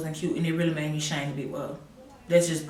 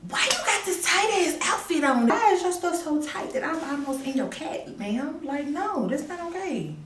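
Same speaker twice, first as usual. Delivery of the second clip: high indignant scolding; 10 semitones higher than usual. Retold or imitated. Scolding friend's tight outfit.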